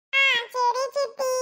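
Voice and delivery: put-on a good boy giving his word; little boy promising. Boy promising his aunt he'll behave, high child voice.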